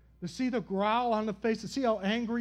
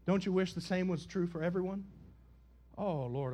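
ordinary talk, nothing marked

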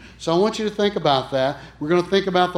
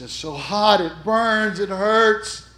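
agonized cry; 5 semitones higher, 4 dB louder, slower than usual. Retold or imitated. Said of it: "still screaming in agony".

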